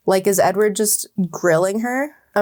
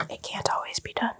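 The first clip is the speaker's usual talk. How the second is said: unvoiced, very soft whisper; hushed whispered protest; 3 semitones higher, 11 dB quieter than usual. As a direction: drop to a bare whisper. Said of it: "I whispered".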